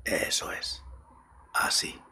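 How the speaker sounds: soft close whisper into the ear; right in your ear. Acted. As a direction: breathe the words close to the mic. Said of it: "apaga la luz o cierra los ojos y escucha mi voz".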